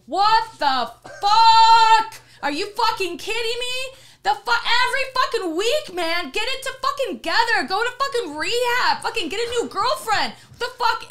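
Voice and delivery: high, loud angry yell out the window; furious yelling. Retold or imitated. Yelling from the window at the drunk neighbor, furious. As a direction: scream at him to go to rehab. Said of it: "I finally go to the window and I go".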